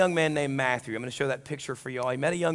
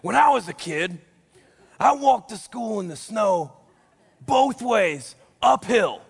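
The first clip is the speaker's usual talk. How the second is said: louder, higher and raspy, like a grandpa; hammed-up raspy "back in my day" voice; raspy old-timer voice; 5 semitones higher, 6 dB louder, slower than usual. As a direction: play the grumpy old-timer bragging about hardship. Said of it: "always said in a raspy voice".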